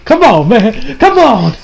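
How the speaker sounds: rising to a high, exasperated cry; pleading fan protest. Retold or imitated. Re-enacting his pleading fan reaction.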